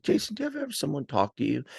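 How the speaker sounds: imitating mom. Mom coming in to tell her son a story, imitated high and fast. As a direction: launch in as mom, high and rapid. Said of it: "'Cause she comes in like this".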